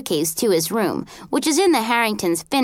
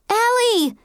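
high cry as if from far away; distant scream; 12 semitones higher than usual. A narrator's reading. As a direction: cry out the name, pitched way up. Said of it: "heard Sophie scream".

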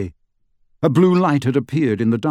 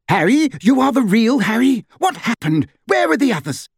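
much higher, anxious mother's cry; panicked mother calling out questions; frantic cry; 11 semitones higher than usual. A narrator's reading. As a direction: cry out in alarm for her family. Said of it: "cried Missus Weasley".

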